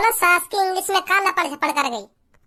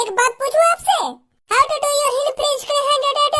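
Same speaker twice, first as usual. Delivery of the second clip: higher, sweet mock girl asking a favour; mock girl from a film; 5 semitones higher than usual. Mocking how the girl came up to him in the library, higher girlish voice. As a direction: ham up a film heroine asking politely. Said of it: "film में मैंने आज तक कोई ऐसा scene".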